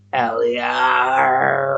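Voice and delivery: mock metal battle cry. Reviewer quoting the band Vikings of Sparta, mock battle-cry shout. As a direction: belt it like a band chant. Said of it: "if you listen to Vikings of Sparta".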